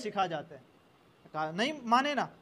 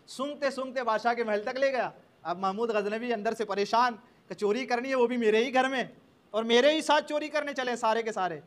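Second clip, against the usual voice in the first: ordinary talk, nothing marked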